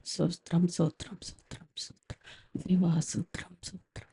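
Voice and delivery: mock-timid prayer fading to a whisper; whispered, barely audible praise to God; mumbled inaudible prayer. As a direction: mumble the praise so no one can hear. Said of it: "ప్రార్థన చేయండి అని pastor గారు అనగా".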